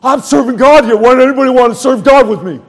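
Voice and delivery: self-mocking furious grumble; angry complaint. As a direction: rant like a sulking elder brother. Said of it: "I was like the elder brother".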